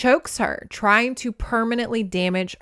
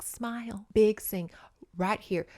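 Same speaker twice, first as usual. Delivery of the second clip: unvoiced coaching whisper; stage mom whisper; 6 dB quieter than usual. Ghost stage mom coaching Mavis, whispered. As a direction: mouth it from the wings. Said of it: "little ghost stage mom".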